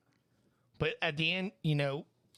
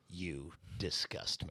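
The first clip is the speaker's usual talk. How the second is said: disgusted; 9 semitones lower, 7 dB quieter, slower than usual. Real, not acted. Reacting to a gross joke.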